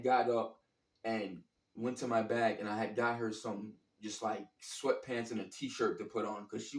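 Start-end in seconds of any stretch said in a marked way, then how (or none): none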